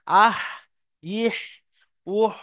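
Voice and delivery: আহ, ইহ, উহ as separate syllables closed on a throat h; ha with three vowels. Shown as a demonstration. Teacher models how to pronounce the letter, demonstrating. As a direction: drill আহ, ইহ, উহ, pausing after each. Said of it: "এইরকম ভাবে".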